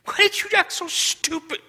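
imitating his own anger at himself; scolding yourself. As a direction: berate yourself for acting stupid. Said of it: "I was angry at me".

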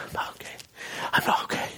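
weak, whispery gasp; imitating out of breath. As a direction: act too winded and ill to use the voice. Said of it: "he's out of breath".

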